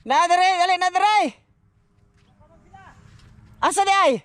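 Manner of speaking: calling out. Real, not acted. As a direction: call out across the yard. Calling out to kids.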